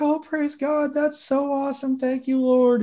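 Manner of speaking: high, delighted voice; happy praise. Retold or imitated. Imitating Linda hearing the good news, high and happy. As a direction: lift way up, overjoyed and thankful. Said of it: "and she's like".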